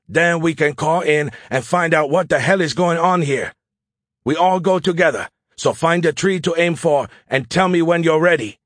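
high, forceful officer's voice; Zhao. Acted. Officer readying squad to run from sniper, commanding. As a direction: give urgent orders to the squad.

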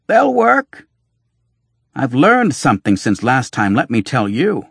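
loud, high snapping reply; sharp retort. Acted. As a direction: whirl round and snap back hard. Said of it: "The red-haired doctor whirled on him".

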